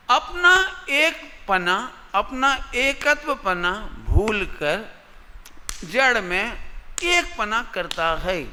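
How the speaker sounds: very slow, spaced-out teaching delivery; slow, emphatic, word by word for students; speaking slowly. Real, not acted. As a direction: lay out each word slowly and deliberately. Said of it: "धीरे धीरे बोल रहा हूँ".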